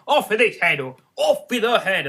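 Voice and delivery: loud, furious royal command; furious shout. A narrator's reading. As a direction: bellow it like a raging queen. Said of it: "stamping about and shouting".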